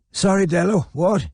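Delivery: put-on a deaf old man straining to hear; feeble old man. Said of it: "said the man feebly".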